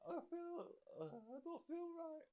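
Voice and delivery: mock faint, dying away; faint and weak. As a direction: sound like you're about to pass out. Injured wife growing faint.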